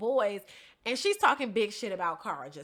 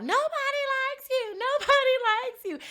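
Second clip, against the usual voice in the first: joking mock yell; 13 semitones higher than usual. Imitating a man lightening the situation for the target, high joking yell. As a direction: stretch it out high, making light of the taunt. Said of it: "So he's yelling out".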